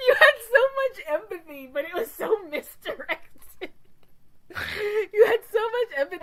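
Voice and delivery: high, loud, laugh-choked teasing; crying with laughter. Real, not acted. Teasing Brenna about misplaced empathy, crying with laughter. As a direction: gasp it out through helpless laughter.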